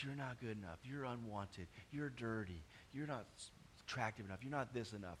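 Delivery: voice of shame. Acted. Preacher voices the lie of shame to listeners, low and soft. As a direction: list them quietly, low in the voice. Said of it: "that lie".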